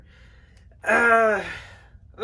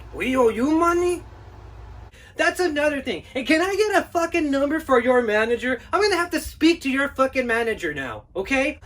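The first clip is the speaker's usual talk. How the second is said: ranting homeowner; 6 semitones higher than usual. Acted. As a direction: vent every grievance at him. Homeowner demands manager.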